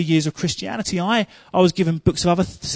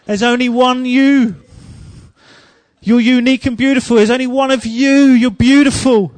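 higher and louder impassioned preaching; preaching at full force; 5 semitones higher, 4 dB louder than usual. Acted. Preacher telling the congregation they are unique, with raised passion. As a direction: preach it out to the whole room.

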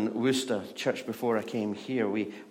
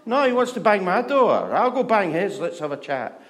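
loud, high mock outrage; indignant husband; 10 semitones higher, 9 dB louder than usual. Husband arguing to bang neighbour's door, indignant. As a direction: rant it, hammed-up, then ease off.

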